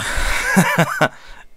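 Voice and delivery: high breathy scoff of a laugh; short derisive burst of laughter. Real, not acted. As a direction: laugh it off in disbelief. Laughing at the plot.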